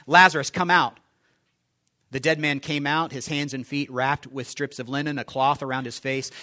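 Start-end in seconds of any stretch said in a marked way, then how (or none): 0.1-1.0 louder, commanding call of "Lazarus, come out". Acted. Jesus calling Lazarus from the tomb, loud and commanding. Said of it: "Jesus called out in a loud voice".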